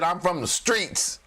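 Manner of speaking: slow mock-tough boast; street-tough Powell. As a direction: brag like a man from the streets. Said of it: "Jay Powell said".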